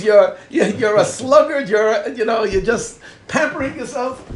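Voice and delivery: loud, playful berating; mock name-calling. Holy book calls its reader names, loud mock scolding. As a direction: hurl accusations at the listener in mock reproach. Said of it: "He calls you names".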